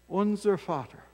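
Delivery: ordinary delivery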